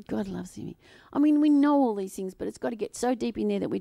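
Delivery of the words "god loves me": breathy hushed whisper; soft whispered declaration; whispered affirmation. Real, not acted. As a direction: whisper it to yourself like a secret. Repeated as whisper.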